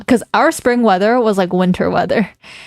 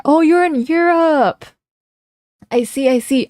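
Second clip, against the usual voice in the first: surprised; 6 semitones higher than usual. Real, not acted. Realizes chatter lives in Europe.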